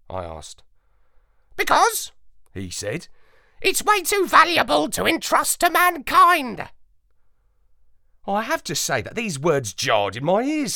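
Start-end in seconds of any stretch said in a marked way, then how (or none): 1.6-2.1 high, loud, sneering parrot voice; a know-it-all bird looking down on the dog; haughty parrot. A narrator's reading. Parrot answers. 3.6-6.7 high, loud, sneering parrot voice; a know-it-all bird looking down on the dog; haughty parrot. A narrator's reading. Parrot answers.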